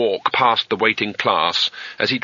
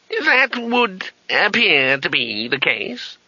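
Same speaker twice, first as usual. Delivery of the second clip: put-on very high, slow incredulous old man; old wizard voice; 8 semitones higher, much slower than usual. A narrator's reading. Old wizard doubting the name through the door, high and slow. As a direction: stretch the name out in aged disbelief.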